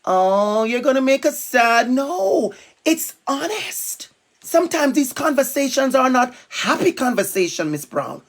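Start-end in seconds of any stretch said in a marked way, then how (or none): none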